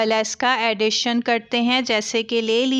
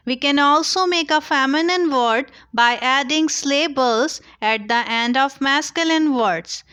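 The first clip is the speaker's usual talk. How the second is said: slow, clear teacher dictation; slow rule reading; 3 semitones higher, much slower than usual. Acted. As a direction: read the rule out slowly like dictation. Reads rule slowly. (धीमे, साफ़ लहजे में नियम पढ़ना)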